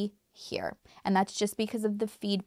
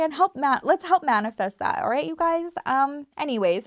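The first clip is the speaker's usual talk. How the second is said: usual speaking voice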